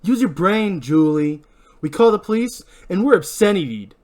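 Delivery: agitated, forceful reasoning; heated argument. Acted. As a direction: argue hard to make them see sense. Barry argues against calling police.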